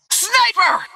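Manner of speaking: high-pitched alarm call; shouted warning. Acted. Warns team of a sniper.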